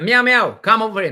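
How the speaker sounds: loud, high meow to coax the cat over; calling the cat. Real, not acted.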